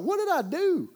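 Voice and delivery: high, bewildered squeak of a question; mock panicked what did I do; baffled cry. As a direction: act out being swamped by texts. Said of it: "I'm like".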